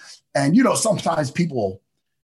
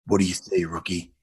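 very low Judge Dredd, dropping softer; Karl Urban's Dredd; 11 semitones lower, 5 dB quieter than usual. Acted. Co-host demonstrating Dredd with face covered, very low impression. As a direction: sink the question into a deep murmur. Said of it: "this is what Judge Dredd looks like".

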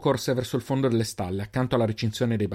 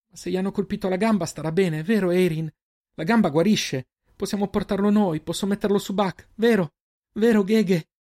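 put-on high, frantic girl's plea; desperate pleading; 9 semitones higher, 4 dB louder than usual. A narrator's reading. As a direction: beg for reassurance, pitch raised throughout. Girl pleads that he will recover.

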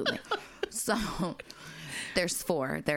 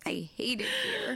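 laughing; 8 semitones higher than usual. Real, not acted. Laughing about dead dad.

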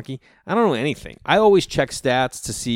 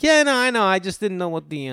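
high-pitched, hurried covering up; unconvincing bluff; 9 semitones higher than usual. Retold or imitated. Caught out by a hockey fan, bluffing unconvincingly. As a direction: imitate yourself faking it badly. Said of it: "He knew I was lying".

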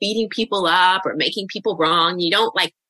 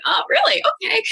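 high, lilting feigned surprise; mock disbelief. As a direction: humour them with an unconvinced, very high lilt. Mock disbelief at client's denial.

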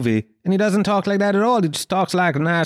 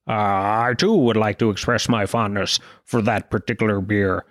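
lower, slurred politician impression; Nixon; 7 semitones lower than usual. Nixon echoing his rival's praise of beer, mock presidential. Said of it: "then it goes to Nixon".

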